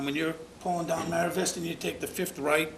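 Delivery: normal talking voice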